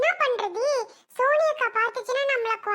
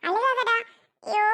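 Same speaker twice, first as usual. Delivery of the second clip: normal talking voice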